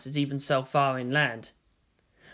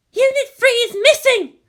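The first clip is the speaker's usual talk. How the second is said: much higher, louder panicked report; urgent alarm; 9 dB louder than usual. Acted. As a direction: cry out the bad news. Maya reports missing unit.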